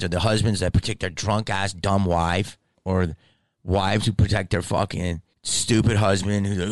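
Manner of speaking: ordinary talk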